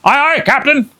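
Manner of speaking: high, eager crewman's reply; pirate crew reply. Acted. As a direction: answer the captain. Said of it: "they answered".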